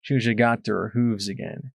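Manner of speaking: ordinary delivery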